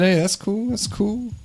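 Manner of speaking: high-pitched, easygoing brush-off; mock reassurance. Comedian to date who says don't judge me, mock reassurance.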